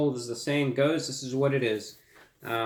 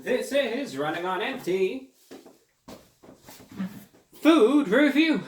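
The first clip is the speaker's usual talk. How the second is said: big announcer-style opening, pitched up; show intro; 9 semitones higher than usual. Acted. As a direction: belt out the show's name, drawing out the last words.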